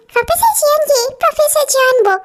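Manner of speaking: a hammed-up imitation of the clingy colleague; mocking imitation. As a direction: mimic her clingy calls to the professor. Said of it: "तब से बस".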